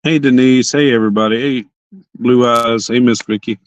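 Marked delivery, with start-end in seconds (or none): none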